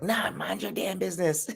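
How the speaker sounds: imitating an angry mother; mad mom. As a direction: tell the stranger to back off. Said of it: "my mom got mad".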